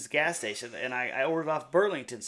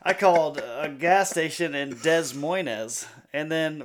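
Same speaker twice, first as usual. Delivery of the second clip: ordinary talk, nothing marked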